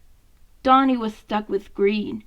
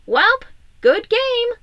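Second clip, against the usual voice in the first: much higher, louder, slow drawn-out concession; good-natured defeat; 11 semitones higher, 7 dB louder, slower than usual. Acted. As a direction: flop back and call it gracefully. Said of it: "Leo said as he flopped backwards".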